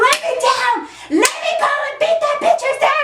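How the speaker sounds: high, fast, furious mock scream; screaming to be released. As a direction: re-enact screaming while being carried off. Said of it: "I'm screaming and kicking".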